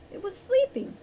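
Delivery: high-pitched protest. A narrator's reading. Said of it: "Wren protested".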